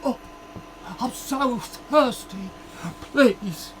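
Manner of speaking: slow, feeble parched little voice; weak voice. Pretend. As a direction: croak it out like you're dying of thirst. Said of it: "and they're going".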